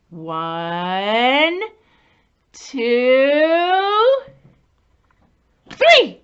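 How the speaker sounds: counting up to a loud, high "three"; building count. Acted. Teacher counting with children before the reveal, building up. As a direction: build the suspense to the big three.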